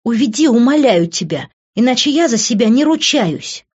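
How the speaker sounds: high, loud woman's threat; desperate angry plea. A narrator's reading. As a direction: beg furiously, at the end of your control. Desperate plea to remove the men.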